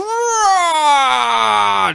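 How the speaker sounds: high, drawn-out straining groan; one long hammed-up cry of effort; straining yell. Showing what Superman is doing, mock straining yell. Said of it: "straining himself".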